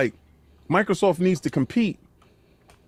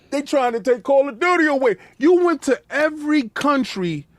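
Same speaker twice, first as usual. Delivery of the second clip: mock desperate plea; 7 semitones higher than usual. Voices Phil begging other countries.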